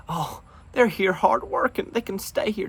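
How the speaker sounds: high, sneering mock voice; mocking quote. Vlogger mocking opponents he disagrees with, sneering high mimicry.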